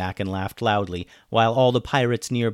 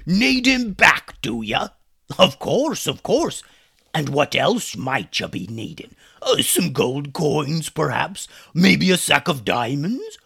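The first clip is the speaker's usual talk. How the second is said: sneering pirate captain, slow and higher; mocking pirate captain; 6 semitones higher, 6 dB louder, slower than usual. Pirate captain taunting the boys, sneering. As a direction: drag out the mockery like a gloating pirate.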